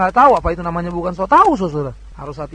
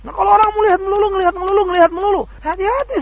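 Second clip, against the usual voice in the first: urgent warning; 10 semitones higher, 5 dB louder, faster than usual. Real, not acted. Preacher warning, rising urgency.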